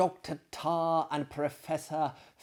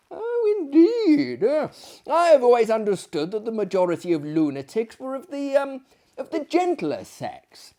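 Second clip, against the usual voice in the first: surprised gentleman; 9 semitones higher, 6 dB louder than usual. Acted. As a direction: sound genuinely taken aback. Narrator character, high surprised voice.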